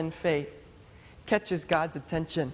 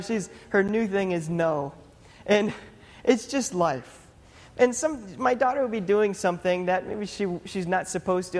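ordinary talk

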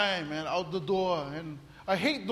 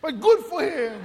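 higher, louder sarcastic good for him; bitter mock blessing; 6 semitones higher, 8 dB louder than usual. Real, not acted. Pastor on the thief, sarcastic.

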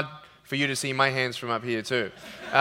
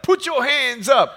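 high, slow commanding cop voice; 10 semitones higher, 5 dB louder, slower than usual. Retold or imitated. Cop pulling the car over, commanding. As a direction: bark it like a traffic cop. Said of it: "they're like".

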